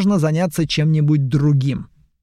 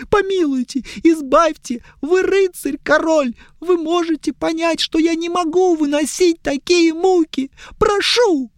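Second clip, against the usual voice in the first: put-on high, pleading young elf; whiny elf; 13 semitones higher than usual. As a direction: beg high and tearful.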